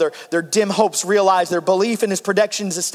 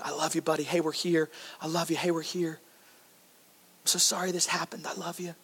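much softer, hushed and loving; tender murmur; 3 semitones lower, 12 dB quieter than usual. Retold or imitated. Mother to her injured little boy, soft and tender. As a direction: soothe a hurt child.

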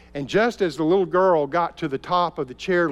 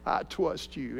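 put-on much softer, a small boy fighting tears; holding back tears; 6 dB quieter, slower than usual. Little boy telling his father he trusts him, softly through tears. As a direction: swallow the tears and say it quietly. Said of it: "he shook his head against his tears".

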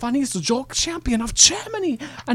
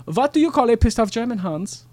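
put-on German accent. Joke repeated to Hans.

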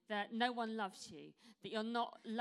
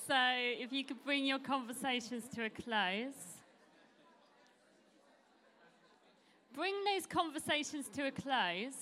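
calling out; 4 semitones higher, 5 dB louder than usual. Real, not acted. Calling group back together.

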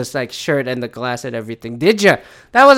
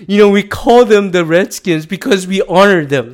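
louder, smug sports-fan voice; mock Redskins fan; 5 semitones higher, 6 dB louder than usual. Mocking a Redskins fan justifying the name, louder and smug. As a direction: ham up a defensive team fan. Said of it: "I would sound like the fucking Washington Foreskins fan".

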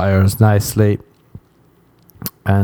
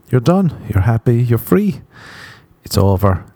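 ordinary delivery

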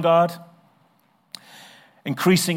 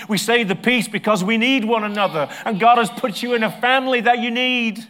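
loud, high, driving sermon voice; fervent preaching; 5 semitones higher, 6 dB louder than usual. Acted. Preacher urging the congregation to need one another, fervent. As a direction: preach it with rising fervour.

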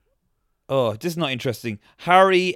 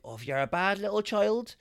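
raised-pitch imitated mother; higher mock-motherly warning; mothers warning children; 6 semitones higher than usual. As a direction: spin a singsong threat for a naughty child. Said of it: "the mothers would go, like, to their children".

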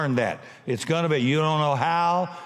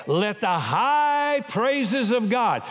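slow, raised psalm reading; preached scripture; 6 semitones higher, much slower than usual. Real, not acted. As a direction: draw out the praise.

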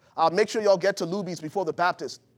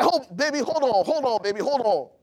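lightly voiced wife, higher; a hint of the wife stalling him; mock wife, higher pitched; imitating his wife; 6 semitones higher, 3 dB louder than usual. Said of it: "Y'all know how y'all do".

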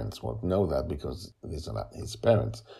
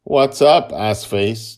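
teasing brother; 6 semitones higher, 10 dB louder, slower than usual. Acted.

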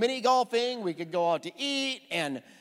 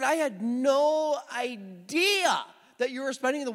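slow, drawn-out, raised disbelief; acting out a stunned husband, higher and slower; incredulous; 5 semitones higher, slower than usual. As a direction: stretch it out in retold astonishment. Stunned husband confronts wife by phone.

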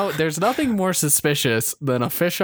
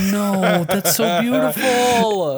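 gushing; 5 semitones higher, 3 dB louder, slower than usual. Real, not acted. Gushing over the robot catfish.

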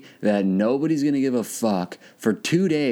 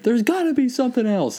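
mock whiny high voice; 14 semitones higher than usual. Continuing the same self quote.

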